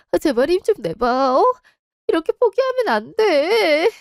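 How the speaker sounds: crying, pitch rising high; tearful begging. Acted. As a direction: sob out the plea to the dying mother. Begging dying mother.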